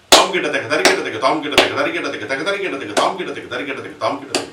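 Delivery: Tom kita teka rattled off quick and loud; fast third speed. Shown as a demonstration. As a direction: pack the whole phrase into four beats. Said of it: "you have to tell the entire phrase".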